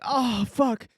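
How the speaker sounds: loud, high oh fuck; groaning at the mess; dismayed curse. Retold or imitated. Seeing the soaked dashboard, a loud dismayed curse.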